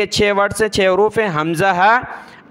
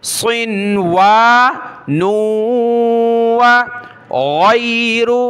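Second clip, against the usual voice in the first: slow recitation; 3 semitones higher, 3 dB louder, much slower than usual. Shown as a demonstration. Teacher recites slowly.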